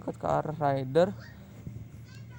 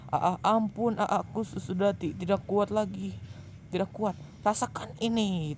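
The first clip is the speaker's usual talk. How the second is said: pained begging; 7 semitones higher than usual. Acted. Harold begs while being tortured.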